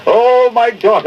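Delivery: high, slow theatrical wail of loss; mock Shylock lament. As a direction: ham up a grieving miser. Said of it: "With the emphasis on the ducats".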